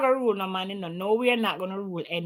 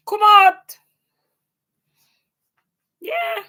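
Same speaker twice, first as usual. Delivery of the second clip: sudden loud, high call out; real shout; 11 semitones higher, 9 dB louder, slower than usual. Real, not acted. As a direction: yell it out across the street. Shouting from afar at someone.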